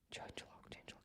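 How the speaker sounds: barely audible whisper; murmured to self. Real, not acted. Web designer hunting for the change log, whispering to self. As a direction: whisper it under your breath while searching.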